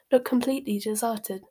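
plain reading voice

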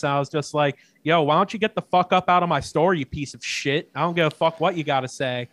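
normal talking voice

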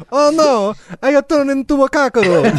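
high, dismayed mock Italian accent. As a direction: lament it like a hammed-up Italian. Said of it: "the Italian accent is approaching".

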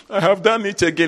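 high, laughing mock whimper; mock crying member. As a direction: whine like a guilty church member.

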